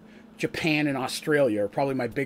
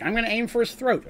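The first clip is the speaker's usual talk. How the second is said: higher, lightly acted player declaring a targeted attack; player calling a shot; 7 semitones higher than usual. Player announcing an aimed throat strike, lightly mimicked. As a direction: toss off the called shot like an eager player.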